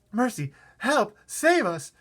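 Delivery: high, loud cries for help; frightened cry. Acted. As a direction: shriek for rescue in panic. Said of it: "cried the ladybird".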